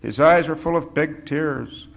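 plain reading voice

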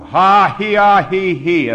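very loud, high acted laugh; laughing loudest. A narrator's reading. Narrator acts own laugh above everybody's, very loud. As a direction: throw out ha-he syllables at full voice. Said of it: "louder than anybody".

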